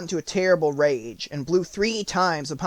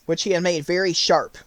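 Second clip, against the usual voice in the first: ordinary delivery, nothing marked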